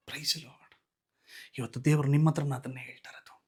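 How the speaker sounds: quick hushed aside; fast whisper. Real, not acted. Intimate address to congregation.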